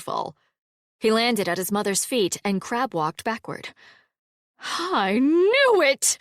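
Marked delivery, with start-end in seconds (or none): 4.6-6.2 very slow, much higher and louder; triumphant cry. A narrator's reading. Aunt Malva catching Halla with a man, triumphant cry. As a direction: crow it out loud. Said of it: "cried Aunt Malva".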